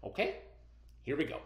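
usual speaking voice

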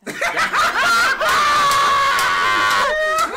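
loud high whooping laugh; shouted laugh. Real, not acted. Bursting laugh at the Pope.

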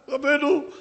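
high, loud call. Acted. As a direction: cry out for the rabbi. The father runs calling the rabbi.

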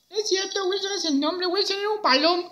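high, cheeky puppet voice; Beto, muñeco respondón. Acted. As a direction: object in a squeaky little puppet voice. Voz del muñeco Beto. (voz aguda de muñeco preguntón)

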